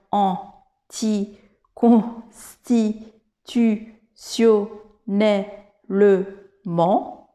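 very slow, each syllable of anticonstitutionnellement set apart. Shown as a demonstration. French teacher showing a long word's syllables, very slowly one by one. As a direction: break anticonstitutionnellement into separate syllables with pauses. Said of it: "prises séparément, les syllabes".

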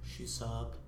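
low, very soft afterthought; hushed deadpan tag; mock stage direction. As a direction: tack it on quietly, dropping in pitch. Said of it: "the stage directions".

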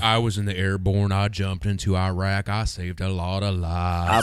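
deadpan mimicry with long drawn endings; Forrest Gump. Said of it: "guy who talked like Forrest Gump".

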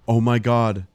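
a hammed-up gasp of sudden realization; mock epiphany. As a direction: play the Nazi who just saw the light. Player voices Nazis' sudden realization.

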